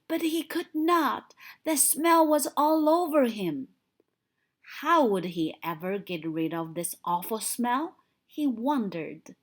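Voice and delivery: ordinary delivery, nothing marked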